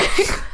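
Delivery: a breathy laugh through tears; tearful laugh. Real, not acted. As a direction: laugh off the crying. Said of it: "a little cry fest".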